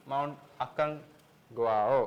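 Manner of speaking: അക്കൺഗ്വാവോ said slowly and carefully, syllable by syllable; tentative slow അക്കൺഗ്വാവോ, checking each sound; unsure pronunciation. Shown as a demonstration. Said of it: "pronunciation എത്രത്തോളം ശരിയാണെന്നറിയില്ല".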